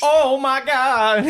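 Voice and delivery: high, loud, drawn-out wail; hammed-up slow exclamation; mock outburst. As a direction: stretch it out in a high mock cry. Mocking the clip's outbursts.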